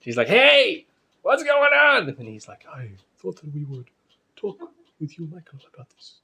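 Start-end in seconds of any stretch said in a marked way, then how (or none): 0.3-2.1 loud. Retold or imitated. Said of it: "his wife is just so loud". 2.7-6.2 soft, timid murmur; quiet voice. Retold or imitated. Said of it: "just the quietest voice you ever heard".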